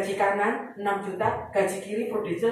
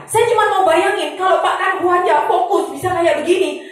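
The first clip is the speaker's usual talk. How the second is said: passionate call; 7 semitones higher, 7 dB louder than usual. Real, not acted. Passionate appeal to audience.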